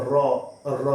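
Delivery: র trilled, tongue tapping again and again; tongue drill; rolled r. Shown as a demonstration. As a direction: roll the র with the tongue. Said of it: "জিহ্বার এটা আপনার বারবার touch খাবে".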